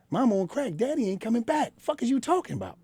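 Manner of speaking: put-on young boy telling the OG his pain; mimicking a frustrated young boy. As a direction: play the kid spitting it out, pitch climbing. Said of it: "that's what I mimic".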